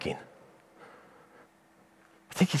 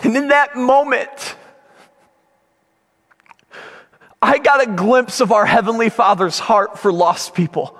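raised, emphatic preacher's voice; impassioned preaching; 8 semitones higher than usual. Real, not acted. Preacher sharing God's heart for lost people, impassioned. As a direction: drive the lesson home with feeling. Draws the lesson with intensity.

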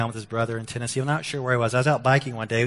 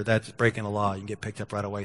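normal talking voice

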